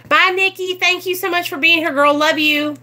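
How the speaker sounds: high, sing-out farewell to a friend; affectionate goodbye. Real, not acted. Bidding a departing viewer goodbye.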